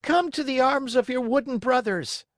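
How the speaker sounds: high, welcoming puppet call; loving invitation. A narrator's reading. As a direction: invite him in with open arms. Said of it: "loving invitation".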